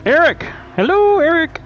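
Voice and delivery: high singsong call to someone not responding; stretched, high-pitched hello. Real, not acted. Calling out to absent driver Eric.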